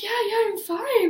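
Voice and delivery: mock casual, clearly flustered; flustered brush-off.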